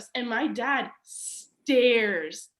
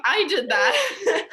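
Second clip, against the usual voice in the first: higher, louder and slow, laughing through it; 7 semitones higher, 6 dB louder, much slower than usual. Retold or imitated. Repeating the sister's brag, laughing at the mall girls. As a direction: crack up while saying it. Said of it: "And I was like".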